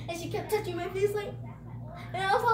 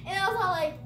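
usual speaking voice

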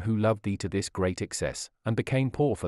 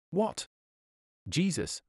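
high, rising astonished question, then the name; amazed; 6 semitones higher, 3 dB quieter, slower than usual. Acted. Sinner asking Jesus about his sacrifice, amazed. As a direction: ask it in hushed disbelief, pitched up.

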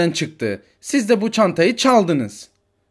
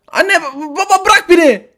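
put-on high, loud kid calling out; a desperate little boy hunting for mom; frantic child; 13 semitones higher, 8 dB louder than usual. Child Arda searching the station for his mother, frantic.

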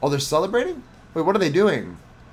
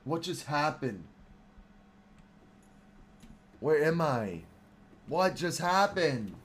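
soft, slow, lost questions; quiet bewilderment; 4 semitones lower, 9 dB quieter, slower than usual. Real, not acted. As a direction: ask it slowly and quietly, dazed. Lost after sudden game event.

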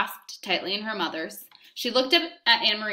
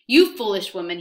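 louder, contemptuous officer; spat insult; 2 semitones higher, 8 dB louder than usual. Acted. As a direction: spit the words at her. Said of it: "he spat".

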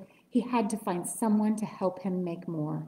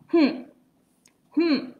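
doubtful hmm hmm, higher; humph; 6 semitones higher, 4 dB louder than usual. A narrator's reading. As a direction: grunt it out disapprovingly. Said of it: "humped a voice".

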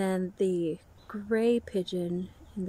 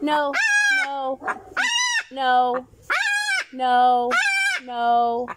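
loud repeated no at the bird; loud firm no to the bird; 5 semitones higher, 8 dB louder, much slower than usual. Real, not acted. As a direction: keep saying no, loud and sharp. Scolding the bird.

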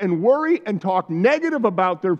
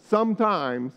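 normal talking voice

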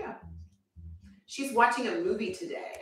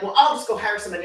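husband's retort; 9 dB louder, much faster than usual. Retold or imitated. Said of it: "he'd be like".

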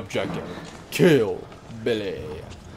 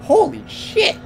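shocked cry; 6 dB louder, much slower than usual. Real, not acted. Horror sight in a game.

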